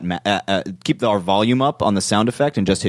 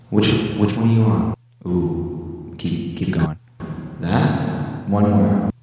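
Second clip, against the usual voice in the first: moon voice effect; much slower than usual. Real, not acted. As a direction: voice through a spacey echo effect. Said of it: "Put me on the moon".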